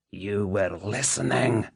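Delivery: menacing hiss, drawn out slowly. A narrator's reading. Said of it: "he hissed".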